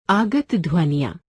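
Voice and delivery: ordinary talk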